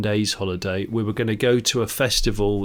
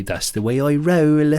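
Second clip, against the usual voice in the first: Bristol gangster quote; 4 semitones higher, 4 dB louder than usual. Retold or imitated. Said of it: "he'd say".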